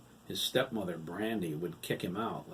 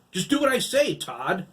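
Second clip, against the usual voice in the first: loud, high furious order; old farmer raging at the boy; 10 semitones higher, 10 dB louder than usual. Acted. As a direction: explode at him. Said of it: "Hiram raged again".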